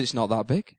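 a hint of a low, flat wife voice; unimpressed wife. Wife doubting the rat's size, lightly voiced.

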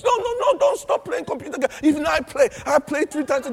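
emphatic protest. Real, not acted. Preacher protesting against quitting games, high and loud. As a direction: cut them off, don't let them quit.

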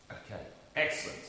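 ordinary talk, nothing marked

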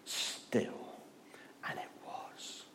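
near-whispered calm, trailing into silence; hushed command. Acted. Preacher voicing Jesus stilling the storm, hushed. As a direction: hush it right down, softly.

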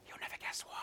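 excited gasp, then a whisper; hushed, conspiratorial kid's whisper; secret whisper. Acted. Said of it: "when you've got a secret".

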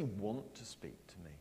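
unvoiced whisper of doubt. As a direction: breathe the question as a private doubt. Preacher voicing a doubter's whisper.

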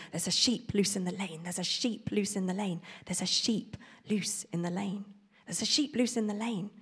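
soft repeated muttering under breath; repeating to himself. Acted. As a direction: mutter it over and over to remember. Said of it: "repeated the message over and over".

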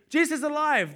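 just shout the news at her; loud proclaiming angel; loud announcement. Retold or imitated. Blurting news instead of engaging.

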